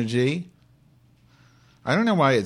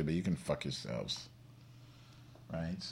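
muttered aside; 6 semitones lower, 17 dB quieter than usual. Real, not acted.

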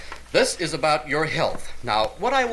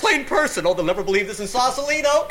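fast, high gushing fan; giddy excitement; 10 semitones higher, faster than usual. Acted. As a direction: gush like a starstruck fan. Star-struck caller gushing.

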